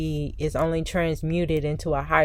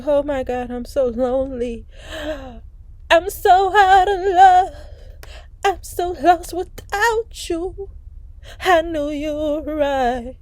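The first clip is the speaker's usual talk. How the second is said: high, slow, whiny wail of heartbreak; mock lonely lament; 8 semitones higher, slower than usual. The lonely querent voiced as a wailing, crying lover. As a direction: ham up a lovesick sob. Voicing the stuck lonely energy.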